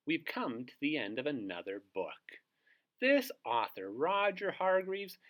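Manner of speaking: ordinary delivery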